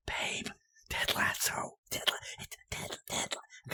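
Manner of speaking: retold urgent cinema whisper. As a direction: whisper while groping for the name. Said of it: "I don't talk in movie theaters".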